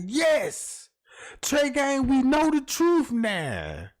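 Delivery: lightly hammed-up fan approval; imagined cheering fans. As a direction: gush like grateful fans. Imagined fans praising him.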